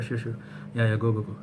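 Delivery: normal talking voice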